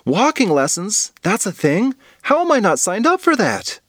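put-on high-pitched, astonished kid; alarmed disbelief, rising high; wide-eyed surprise. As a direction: react as if missing out on something big. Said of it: "Rose's eyes widened".

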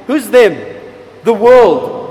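ordinary talk

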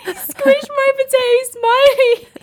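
high, wailing laughter-tears; laugh-crying. Real, not acted. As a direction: squeeze it out while helplessly laugh-crying.

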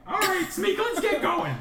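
high, loud cheery scout leader; rally the troop onward; scoutmaster voice. Acted. Scoutmaster to his scout, a high hearty rally.

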